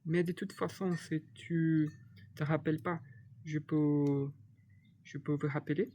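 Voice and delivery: usual speaking voice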